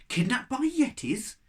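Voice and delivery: high, shocked question. Acted. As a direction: gasp it out in disbelief. Shocked at the news.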